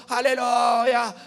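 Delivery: slow, loud, stretched alleluia; hammed-up cry of praise; a fervent believer overdoing it; mock shouted alleluia. Climax of the mock prayer.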